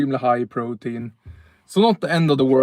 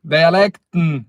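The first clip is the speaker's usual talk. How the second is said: northern Swedish dialect; 3 semitones higher, 5 dB louder, slower than usual. Shown as a demonstration. Streamer showing chat his northern Swedish accent, drawn out and proud. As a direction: stretch out Dialekten the way a Norrland speaker would. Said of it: "Det kan du höra på dialekten".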